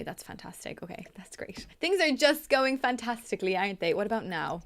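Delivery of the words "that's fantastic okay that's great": whispered dry sarcasm under the breath; sarcastic whisper. Real, not acted. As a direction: mutter it like a bitter joke. Game keeps failing, sarcastic aside.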